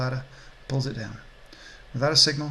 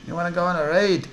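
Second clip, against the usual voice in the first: higher, louder Scottish pitchman; Scottish accent; 4 semitones higher, 5 dB louder than usual. Acted. Orc dealer touting a ride, lilting Scottish accent. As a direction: offer it with a Scottish lilt. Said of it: "Don't know why he's Scottish".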